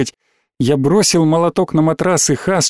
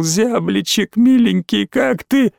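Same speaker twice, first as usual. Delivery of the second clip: high, plaintive whine climbing upward; thin womanish whimper; 6 semitones higher, slower than usual. A narrator's reading. Small boy calls injured friend through door, whimpering. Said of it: "тоненько бабье скулил".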